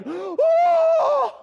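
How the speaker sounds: very high, loud, held "oh"; hammed-up shout of amazement; over-the-top chorus of wonder; awestruck angels. Angels amazed at man's creation.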